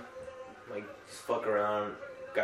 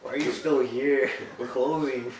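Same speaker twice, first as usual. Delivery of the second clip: annoyed closing clerk; 4 semitones higher, 6 dB louder than usual. Pretend. Acting annoyed closing worker.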